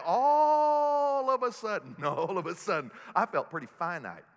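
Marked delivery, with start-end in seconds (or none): 0.0-2.0 loud, high preaching shout; loud shouted build-up of the earthquake story; big shout. Real, not acted. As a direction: belt all of a sudden to the back row. Dramatic climax of quake story.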